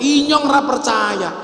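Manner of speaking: high, slow mock-incredulous outcry; retold Ngapak shout. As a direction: protest in drawn-out Ngapak disbelief. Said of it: "Orang ngapak langsung teriak". (pura-pura teriak tak percaya ala Ngapak)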